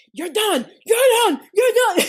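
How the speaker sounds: retold coach kicking a girl out, angry high shout; coach yelling. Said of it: "He was like".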